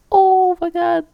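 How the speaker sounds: high squeal of delight; excited squeal. Real, not acted. Gamer squealing at the cute girl on screen. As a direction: squeal like something adorable just appeared.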